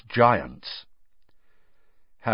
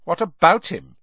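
hard, cold and louder retort; 9 semitones higher, 7 dB louder than usual. A narrator's reading. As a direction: shut him down coldly. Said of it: "said Parvati in a hard voice".

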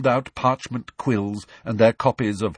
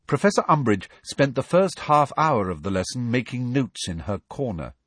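plain reading voice

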